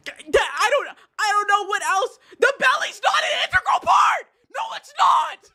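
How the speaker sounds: high, exasperated shout; real worked-up squawk of protest; getting so mad. Real, not acted. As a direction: climb into a high-pitched, fed-up yell. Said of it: "I'm getting so mad".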